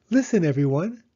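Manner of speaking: put-on high, eager child voice; a small girl begging her mother to listen; little girl Penny. Little Penny asks Mama to listen, high and eager.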